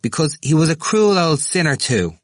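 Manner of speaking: high, indignant outburst; heated denunciation. A narrator's reading. Temple answering Glynn about the church, indignant. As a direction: fling out the accusation at high pitch.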